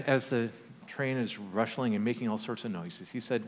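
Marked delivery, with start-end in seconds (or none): none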